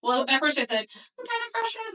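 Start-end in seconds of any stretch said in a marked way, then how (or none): 1.2-1.9 much higher, softer, tentative admission; tentative hedging. Retold or imitated. Mom first admitting frustration to her boss, hedging. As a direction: play it down in a small high voice.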